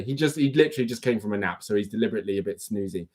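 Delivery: ordinary talk, nothing marked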